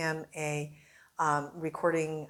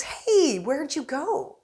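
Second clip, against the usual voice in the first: Bill Cosby impression; 9 semitones higher, much slower than usual. Host imitating Bill Cosby catching a reporter. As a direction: stretch every word like Cosby. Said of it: "who calls me and says".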